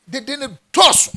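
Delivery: high, loud exclamation; preacher's excited outburst; exclaimed high and loud. Acted. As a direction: burst out with it.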